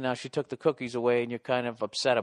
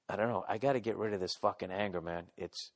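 ordinary talk, nothing marked

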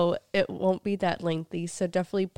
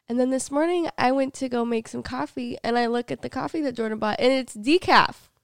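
indignant; 5 semitones higher, 6 dB louder than usual. Real, not acted. Outraged discovery of decaf.

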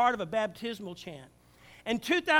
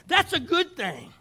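1 emphatic declaration; 7 semitones higher, 8 dB louder, slower than usual. Real, not acted. Emphatic preaching.

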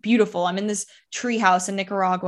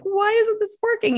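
much higher, panicked retold question; panicked cry; 14 semitones higher than usual. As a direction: let the voice jump up as the panic is relived. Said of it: "in a state of panic".

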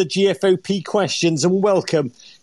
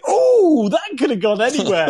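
son imitating dad's shout; 7 semitones higher than usual. Son imitating dad shouting at the TV, laughing. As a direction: mimic your dad yelling at the telly. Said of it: "shooting at the television going".

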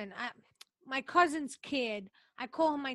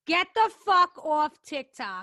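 loud comic order to mom; mock scolding; 2 semitones higher, 9 dB louder than usual. Daughter retells ordering mom off TikTok, loud mock scolding. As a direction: tell her off, loudly.

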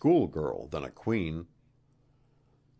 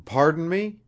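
higher, louder apologetic question; begging pardon; 11 semitones higher, 6 dB louder than usual. A narrator's reading. Wilson not following the queen's reason, begging pardon. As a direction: beg pardon with raised voice. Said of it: "begged Wilson".

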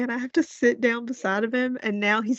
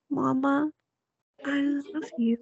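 put-on higher, small toddler voice; little boy's voice; 5 semitones higher, 3 dB quieter than usual. Son saying good night to mom, imitated small-child voice. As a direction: echo it like a sleepy little child. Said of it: "And he goes".

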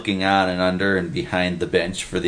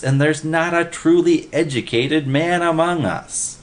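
higher, emphatic lament; worked-up expert; 6 semitones higher than usual. A narrator's reading. Jones laments that everyone is a specialist, worked up. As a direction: hammer out the disappointment. Jones rants about the cards.

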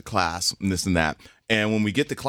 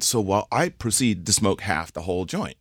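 normal talking voice

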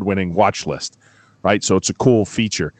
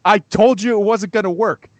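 furious reprise; 10 semitones higher than usual. Retold or imitated. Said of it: "I was like".

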